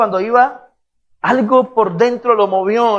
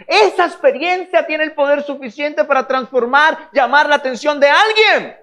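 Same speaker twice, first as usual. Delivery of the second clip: high, loud, forceful preacher voice; preacher urging the church to share their experience, impassioned preaching; 7 semitones higher, 4 dB louder than usual. Real, not acted. Drive the point home at a high pitch.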